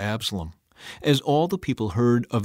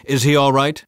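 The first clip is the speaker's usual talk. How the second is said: raised, louder question; higher, insistent demand; 4 semitones higher, 6 dB louder than usual. A narrator's reading. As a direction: press urgently for an answer. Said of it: "the king demanded".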